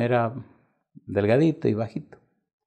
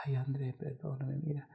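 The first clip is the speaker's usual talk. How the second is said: soft pleading aside; 5 semitones higher, 11 dB quieter than usual. Retold or imitated. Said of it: "ser bien duro y decir".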